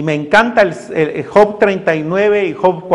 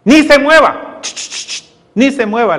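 God silencing Job; 5 semitones higher than usual. Retold or imitated. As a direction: shush him like a strict father. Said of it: "le dice el Señor a Job".

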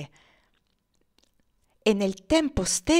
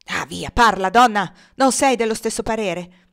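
loud, forceful push to answer; impatient demand; 2 semitones higher, 8 dB louder than usual. A narrator's reading. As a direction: press her hard for an answer. Legré presses the woman to speak. (incalzante, a voce alta)

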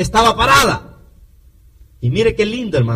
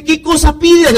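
raised, louder king's voice; king's question; 9 semitones higher, 6 dB louder than usual. Retold or imitated. King asks, voiced by preacher.

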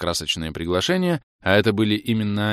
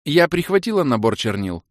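high eager call; excited exclaim; 8 semitones higher, 4 dB louder than usual. Acted. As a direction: blurt it out happily. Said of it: "воскликнула Кэлен". (радостное восклицание)

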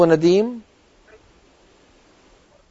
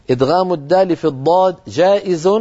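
usual speaking voice

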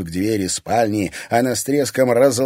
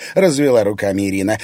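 ordinary delivery

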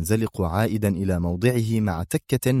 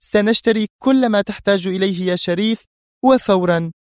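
high, decisive order; firm; 11 semitones higher, 4 dB louder than usual. A narrator's reading. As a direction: settle it with firm authority. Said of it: "قالت منى في حزم".

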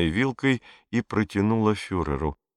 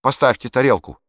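firm command; 5 semitones higher, 5 dB louder than usual. A narrator's reading. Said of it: "велел Пьеро".

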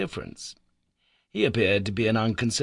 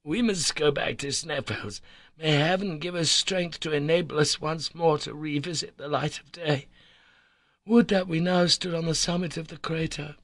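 higher, fervent plea; getting excited; 8 semitones higher than usual. A narrator's reading. Harry begging to go back to Sneffels, excited. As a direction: wish it aloud with rising fervour. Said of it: "getting excited by my own words".

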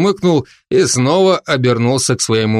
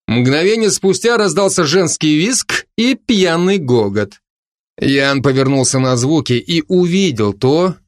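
usual speaking voice

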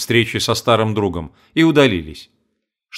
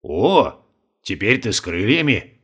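surprised; 3 semitones lower, 4 dB quieter than usual. Acted. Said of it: "подивился старик".

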